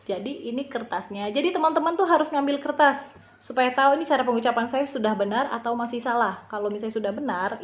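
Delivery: ordinary delivery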